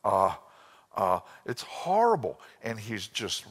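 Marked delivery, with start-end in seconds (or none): 0.0-1.2 flat droning uh sounds; monotone imitation. Imitating a monotone preacher stuck on uh. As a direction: lightly mimic a monotone speaker stalling. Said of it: "He's monotone".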